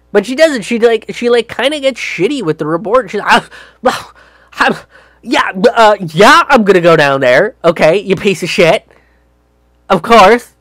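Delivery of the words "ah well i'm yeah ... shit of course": high, loud sarcastic snap; sarcastic mock reply to the reporter, getting louder; snarky. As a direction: throw it back at the reporter with contempt. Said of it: "snarky remark".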